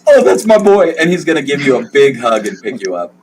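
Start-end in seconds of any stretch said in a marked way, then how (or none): 0.1-0.9 high, loud doting father; beaming father voice, much higher; proud dad. Acted. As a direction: gush over your son. GM plays the father.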